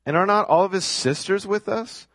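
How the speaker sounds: high incredulous question, rising all the way; mocking crowd voice; sarcastic. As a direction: scoff it as a disbelieving neighbor. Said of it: "Can you hear the sarcasm here?".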